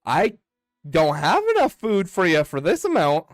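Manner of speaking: high, loud startled villager; wide-eyed surprise. Acted. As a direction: raise the voice, taken aback by the offer. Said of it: "Her eyes go really wide and then says".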